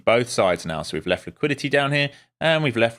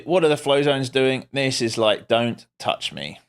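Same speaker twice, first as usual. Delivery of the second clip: ordinary talk, nothing marked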